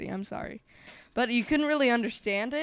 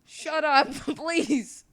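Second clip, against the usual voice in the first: laughing, half-pleading; laughing shut up; 6 semitones higher, 3 dB louder, much slower than usual. Real, not acted. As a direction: giggle through the protest. Laughing protest at friend.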